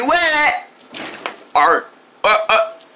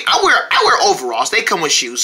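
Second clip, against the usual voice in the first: ordinary delivery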